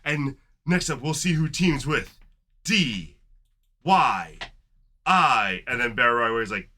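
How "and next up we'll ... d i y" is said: slow, spaced-out D Y I, getting louder; emphatic announcer. Retold or imitated. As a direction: spell out D Y I slowly and loudly. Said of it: "he was, like, very emphatic about it".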